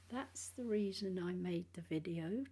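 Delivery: usual speaking voice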